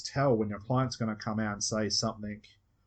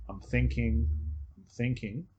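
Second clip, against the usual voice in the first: mock thinking murmur. Shown as a demonstration. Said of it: "So I'm thinking".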